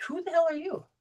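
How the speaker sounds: high, startled mock indignation; baffled stranger. Stranger confronted by someone they never met, high and startled. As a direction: play the confused stranger. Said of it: "They're like".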